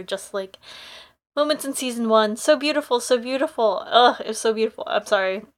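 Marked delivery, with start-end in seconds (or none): none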